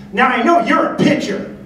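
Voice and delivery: put-on loud-pitched old ballplayer's pronouncement, high; high, emphatic impression of a baseball great; Ted Williams. Baseball great to young man in Padre uniform, high emphatic impression. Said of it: "he turns to me and he says".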